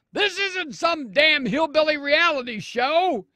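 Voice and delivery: loud, high-pitched yell from the crowd; screaming back. Acted. Someone in the crowd answering Sarah Palin, screaming back. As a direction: holler it back as an outraged heckler. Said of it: "someone in the crowd screamed back".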